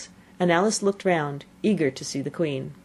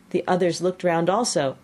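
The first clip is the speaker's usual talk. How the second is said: plain reading voice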